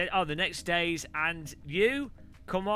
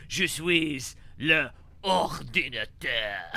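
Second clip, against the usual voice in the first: slow, portentous robot-like French announcement; hammed-up, drawn-out mock French; over the top; 3 semitones lower, slower than usual. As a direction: declare it word by word, absurdly grand. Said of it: "still sounds crazy".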